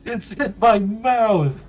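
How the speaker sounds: high, slow, loud wail; hammed-up cry of horror; comic yell. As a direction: yell it drawn-out in laughing disgust. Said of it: "what did you yell?".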